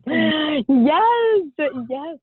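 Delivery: a real catch of emotion in the voice; tearful yes. Real, not acted. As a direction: answer through tears.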